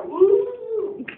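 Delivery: high loud whoop; excited whoop. Retold or imitated. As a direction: let out a gleeful woo. Reaction to getting caught.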